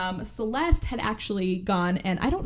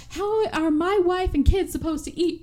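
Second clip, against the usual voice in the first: mock outrage; 8 semitones higher than usual. Acted creditor up in her face.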